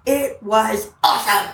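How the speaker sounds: slow, emphatic awe, imitating a child; retold childlike enthusiasm; thrilled kid. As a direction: draw it out like a proud little boy. Son's rosy-cheeked answer after school.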